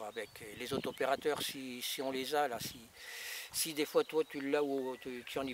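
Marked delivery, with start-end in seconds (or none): none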